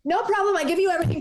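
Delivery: high, chirpy handover; breezy compliance. Retold or imitated. To the robber, mock cheerful handover. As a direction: toss it off like nothing. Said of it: "I just go".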